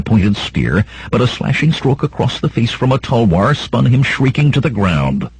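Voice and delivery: usual speaking voice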